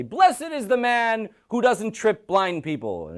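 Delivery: high, slow, drawn-out proclamation; crowd proclaiming. Acted. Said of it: "the people on this mountain say".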